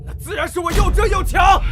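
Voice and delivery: heated retort. Acted. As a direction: fling it back in anger. Prince retorts in quarrel. (愤然高声反驳)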